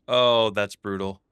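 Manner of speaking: loud, drawn-out groan of dismay. Real, not acted. Player seeing own mess, loudly dismayed. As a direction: cry out with a long "oh".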